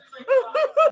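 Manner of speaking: shrill, loud mock cackle; mocking high cackle. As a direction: ham up her laugh, high and loud. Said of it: "this is you laughing at everything".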